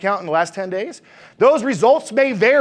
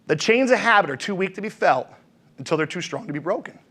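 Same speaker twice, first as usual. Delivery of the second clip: softer and lower, letting it sink in; soft lower repeat; 4 semitones lower, 5 dB quieter than usual. Shown as a demonstration. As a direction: repeat it quietly so it lands. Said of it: "quote said again".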